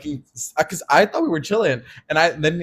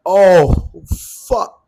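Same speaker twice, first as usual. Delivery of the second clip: loud, dramatic oh-no; hammed-up loud dismay; retold caught-out reaction; 5 semitones higher, 9 dB louder than usual. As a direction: play up the moment of getting caught.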